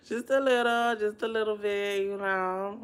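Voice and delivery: high and loud, Just a little through laughter; laughing out loud. Real, not acted. After whispering to viewers, she repeats it laughing loudly. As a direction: blurt it out cracking up.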